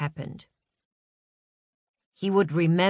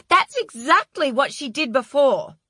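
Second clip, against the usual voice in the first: high, loud, vindicated outburst; screeching satisfaction; 9 semitones higher, 6 dB louder than usual. A narrator's reading. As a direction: shriek it as if proved right. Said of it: "screeched the P A with satisfaction".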